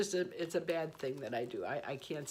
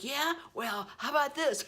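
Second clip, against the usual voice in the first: mock angry retort; 8 semitones higher than usual.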